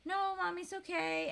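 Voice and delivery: put-on high-pitched toddler reassuring a parent; sweet little child. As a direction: imitate a sweet small daughter. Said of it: "in the sweetest little face".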